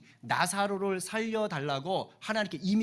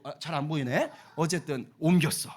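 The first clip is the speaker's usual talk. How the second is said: ordinary talk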